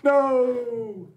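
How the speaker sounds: shocked high cry of no; high protesting no. Real, not acted. Mic about to be cut.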